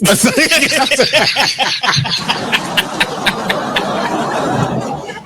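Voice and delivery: high, breathless run of laughter; long helpless laughing fit. Real, not acted. Host cracking up at their own impression, long high laugh. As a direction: crack up and keep going.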